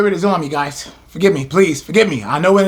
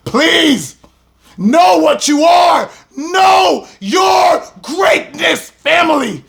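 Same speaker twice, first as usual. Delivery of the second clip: pleading shout; 6 semitones higher, slower than usual. Real, not acted. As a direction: beg them to know their greatness. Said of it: "I plead with my people".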